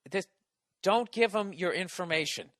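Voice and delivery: ordinary talk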